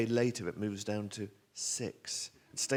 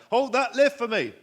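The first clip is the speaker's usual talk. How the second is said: high, very loud urgent shout; desperate full-voice holler; 13 semitones higher, 13 dB louder than usual. Acted. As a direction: yell down the corridor. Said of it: "so I shout".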